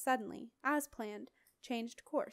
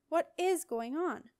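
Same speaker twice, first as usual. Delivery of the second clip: muttered; 6 semitones higher, 5 dB louder, slower than usual. A narrator's reading. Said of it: "muttered Sally".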